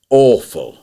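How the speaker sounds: scornful. Real, not acted. Scorning the replacement panto.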